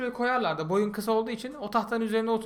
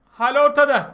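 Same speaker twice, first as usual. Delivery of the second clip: higher, louder deadpan jab; loud punchline; 6 semitones higher, 8 dB louder than usual. Real, not acted. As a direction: hit the punchline hard. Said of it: "Yakışıklı oğlum diye seviyor".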